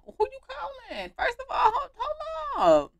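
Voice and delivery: high, loud, laughing outrage; laughing shout. Real, not acted. Gamer shouting at Penny on the phone, laughing outrage. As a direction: yell hold up in mock disbelief.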